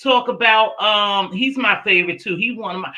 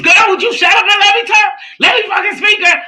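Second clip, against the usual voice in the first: high, loud, fast furious yell; imitating an upset Uncle Tim; 12 semitones higher, 7 dB louder, faster than usual. Uncle Tim yelling at Jay Wilson over the mic, high fast shout. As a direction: shout him down to get a word in. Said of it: "he just gets so upset".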